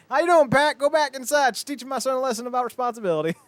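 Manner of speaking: high, brisk dad hollering to a colleague; calling out in passing. Acted. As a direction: imitate a dad cheerily calling out mid-run. Dad calls out while running, retold.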